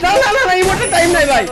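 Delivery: fast, high, loud rush; hurried shout. Real, not acted. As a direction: blurt it out in a hurry. Hectic refusal mid-fight.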